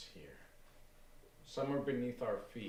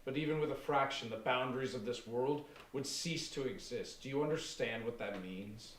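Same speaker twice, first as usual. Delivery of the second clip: rising fervor; 4 semitones higher, 4 dB louder than usual. Acted. Aaron half mad, building.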